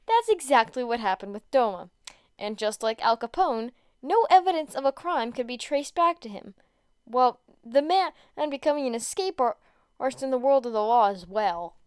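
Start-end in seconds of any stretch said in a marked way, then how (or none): none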